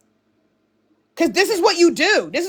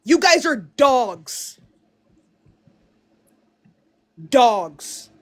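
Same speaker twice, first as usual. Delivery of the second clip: slow contempt; 4 semitones lower, much slower than usual. Real, not acted. Contemptuous rant.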